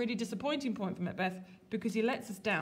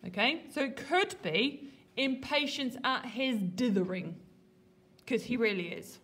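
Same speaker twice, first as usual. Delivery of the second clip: ordinary talk, nothing marked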